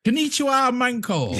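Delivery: high, loud, jokey greeting with laughter; mock greeting. Player off to Japan trying the new word, mock high greeting. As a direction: call it out high, breaking into a laugh.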